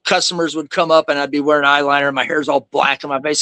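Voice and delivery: ordinary delivery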